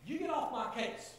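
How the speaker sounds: sudden high, loud snap; mock outburst at a coworker; snapping at Johnny. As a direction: bark it at him, fed up. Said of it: "Tim just looks at Johnny and goes".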